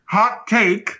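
put-on high-pitched old-time newsreel announcer accent; old radio voice. Co-host announcing his own exit from podcasting, old radio voice. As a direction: declare it like a vintage radio headline, with the accent. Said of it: "offended by that accent".

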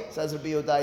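normal talking voice

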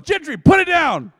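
high, very loud yell at a dog; shouted command. Retold or imitated. Wife to dog holding a squirrel, shouted command. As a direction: imitate a forceful shouted order.

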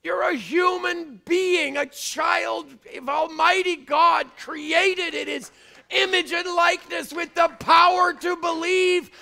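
shouted high sermon climax; full-force preaching. Acted. Preacher urging the church to rise, shouting. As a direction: rouse them, building to a roar.

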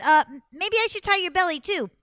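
high, loud, eager suggestion; over-helpful Anansi. Acted. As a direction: pipe up with an innocent offer. Anansi slyly offers more tying.

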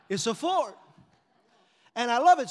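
shouting with joy; proud happy shout; loud excited. Real, not acted. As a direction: belt it out with delight. Excited about his truck.

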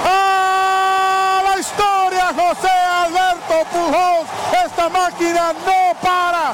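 excited radio play-by-play, shouting; home run call. Real, not acted. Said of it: "Emocionado mi amigo Polo Asensio narrando".

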